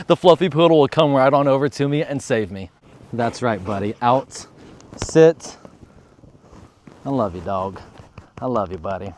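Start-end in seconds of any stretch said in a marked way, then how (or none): 3.1-9.1 much lower, soft murmur to the dog; tender. Real, not acted. Owner softly telling poodle he loves him, tender. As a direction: hush it softly to your buddy.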